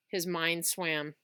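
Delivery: plain reading voice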